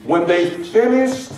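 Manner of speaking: finished leaned on, slow and deliberate; slow down and weigh the word finished; preacher underlining finished for the church; stress on finished. Shown as a demonstration. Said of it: "the word there that I want to emphasize".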